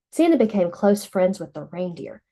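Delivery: plain reading voice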